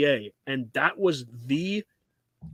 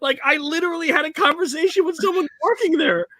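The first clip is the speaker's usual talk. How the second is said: high, loud indignant rant; exasperated rant; 13 semitones higher, 6 dB louder than usual. Real, not acted. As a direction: vent the disbelief. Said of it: "ongoing rant".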